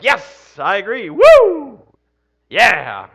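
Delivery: loud, high whooping cheer; cheering at phone. Pretend. Pastor mocking himself cheering at a video, loud whoop. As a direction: whoop at your phone like a fan. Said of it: "stood up and been like".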